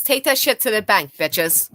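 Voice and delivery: ordinary talk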